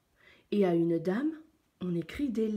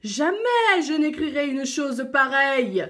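loud, raised, indignant roar; roaring lion; 5 semitones higher, 6 dB louder than usual. A narrator's reading. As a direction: roar the refusal as an outraged lion. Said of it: "rugit le lion". (lion qui rugit, indigné)

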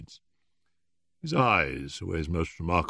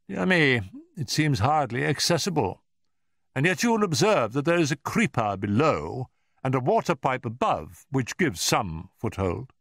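higher, animated detective musing; observant Holmes; 8 semitones higher, 3 dB louder than usual. A narrator's reading. Holmes studies the window's access, keenly observant. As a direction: point out the clues with interest.

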